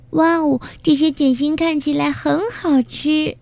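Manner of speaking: put-on high, childlike wonder; surprised little one, pitched high; amazed cry. A narrator's reading. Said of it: "卡门惊奇地喊道".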